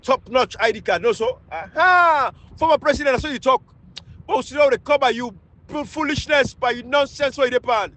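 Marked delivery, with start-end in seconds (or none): 1.5-3.6 mocking shout. Real, not acted. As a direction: call out the ex-president with a scornful cry.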